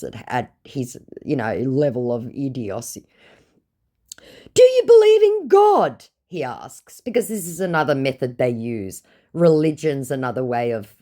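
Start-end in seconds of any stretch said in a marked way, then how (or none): 4.5-6.0 very high, loud put-on voice; mock pious scammer. Scammer asking about God in a very high loud mock voice. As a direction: ham up the earnest question.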